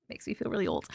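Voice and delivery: sighed whisper, half to herself; whispered aside. Real, not acted. A host reacting to a listener's age, whispered. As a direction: mutter it under a sigh like a private joke. Aside after the high school date.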